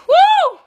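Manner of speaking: two piercing, overwhelmed whoops; exclaimed high "whoo", repeated; loud high whoops. Real, not acted. Worked up about seeing professor.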